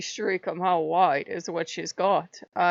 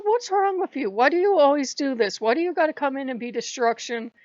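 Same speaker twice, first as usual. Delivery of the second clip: retold yelling; 6 semitones higher, 6 dB louder than usual. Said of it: "I'm yelling at this goddess".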